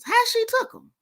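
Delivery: sudden high disbelief; high disbelieving question; incredulous. Real, not acted. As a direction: shoot the voice up in disbelief.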